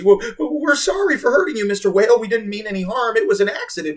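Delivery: high, loud stammering apology; fearful apology. A narrator's reading. Boy apologizing to the whale, fearful and high. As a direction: stammer it out scared. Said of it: "staggering a fearful apology".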